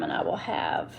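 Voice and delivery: whispered under her breath while searching; muttered aside. Real, not acted. To herself while digging for nail sizes, whispered. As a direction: mutter it to yourself, almost no voice.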